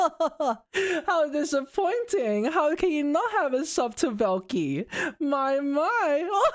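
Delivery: a haughty ojou-sama sneering at a non-subscriber; sarcastic ojou-sama. Pretend. Mocking fans without a sub as a haughty ojou-sama, high and sarcastic. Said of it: "I can do it very sarcastically".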